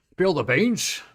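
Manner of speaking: slow, loud drawn-out spill the beans; mock eager gossip; eager gasp. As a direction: gasp then beg for gossip.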